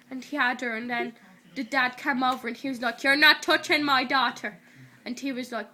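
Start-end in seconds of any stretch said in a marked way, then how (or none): 3.0-4.6 loud retold dad's warning; mock protective anger. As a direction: imitate a dad warning someone off, loudly. Retold dad confronts the boy.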